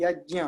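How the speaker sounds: यज्ञ as "yaj-nya"; j, not g, in यज्ञ; Sanskrit pronunciation. Shown as a demonstration. Teacher reads out the joined spelling, "jny" pronunciation. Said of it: "जोड़ करके लिख देते हैं".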